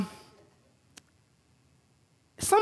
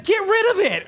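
imitating wife's demand; 9 semitones higher, 3 dB louder than usual. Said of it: "She's like".

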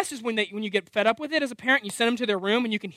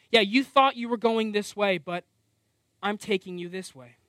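ordinary delivery

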